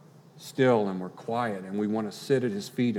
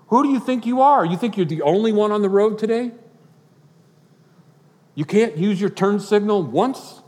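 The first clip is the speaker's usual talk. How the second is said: mock road-rage rant, much higher and louder; indignant outburst; 10 semitones higher, 8 dB louder than usual. Imitating an indignant driver scolding someone on the road, mock angry and loud. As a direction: play the affronted driver, hammed-up. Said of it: "never once got indignant and said".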